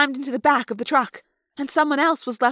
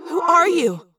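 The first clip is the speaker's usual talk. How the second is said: echoing, higher questioning voice; asked in thought-speak; 5 semitones higher, 3 dB louder than usual. A narrator's reading. Confused Rachel questioning the girl, echoing asked question. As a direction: ask it with echo, short of a yell. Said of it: "I asked".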